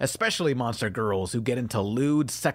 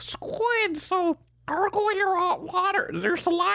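put-on much higher, gargled squid kid voice. Friend sets the podcast's new squid voice rule, squeaky and high. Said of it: "we all have to talk like".

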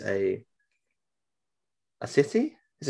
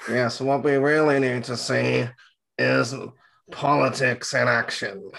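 drawn-out put-on character voice; slow, exaggerated impersonation sliding into Roz; George impression; slower than usual. Co-host mocking the creator's love of politics, put-on impression. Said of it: "I'm not sure why he's turned into Roz from Monsters".

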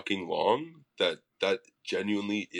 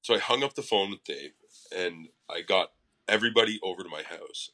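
ordinary talk, nothing marked

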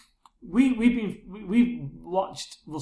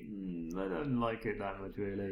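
slow, much softer put-on voice; mock inner reply; 3 semitones lower, 11 dB quieter, slower than usual. Voicing the viewer's reluctant thought, soft and slow. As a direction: murmur it back, unimpressed and slow. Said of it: "You think".

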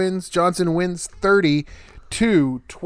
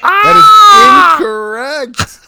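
high, loud, slow game-show buzzer cry; wrong-answer shout; 13 dB louder, much slower than usual. Pretend. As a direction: blast it like a wrong-answer alarm. Game host rejects wrong answer.

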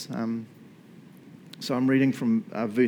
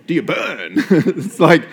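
laughing; 4 semitones higher, 7 dB louder, slower than usual. Real, not acted. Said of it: "laughing question to audience".

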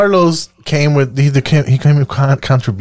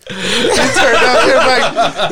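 laughing; 8 semitones higher, much slower than usual. Real, not acted. Laughing at muted friend.

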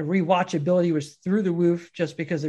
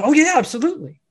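higher, eager oh yeah; a hint of a hungry hero jumping at seconds; eager yes; 7 semitones higher, 4 dB louder, much faster than usual. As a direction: grab at the offer of food. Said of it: "And he goes".

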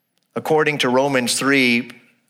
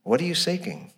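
soft direct question; 3 semitones higher, 6 dB quieter than usual. Real, not acted. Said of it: "So I would ask you".

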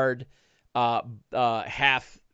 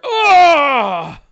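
loud high ogre howl; ogre's pained cry; 14 semitones higher, 12 dB louder than usual. Acted. Ogre slashed in the leg, loud pained howl. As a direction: howl as the blade hits. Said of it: "Uh, the ogre".

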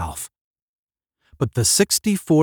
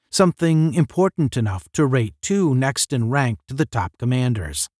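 ordinary delivery

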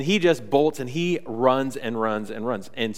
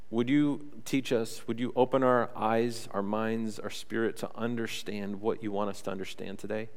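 prayer; 5 semitones lower, 9 dB quieter than usual. Acted. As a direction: pray softly over the congregation. Pastor leads opening prayer.

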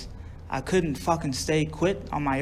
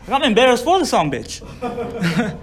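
higher, louder, laughing at the end; amused embarrassment; 5 semitones higher, 4 dB louder than usual. Real, not acted. Speaker embarrassed for the group leader, amused and laughing. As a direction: break into a laugh as you say it. Laughs at his own story.

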